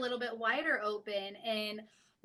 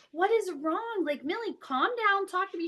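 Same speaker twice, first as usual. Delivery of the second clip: worried mom; 7 semitones higher, 3 dB louder than usual. Retold or imitated. Mother calms sobbing daughter.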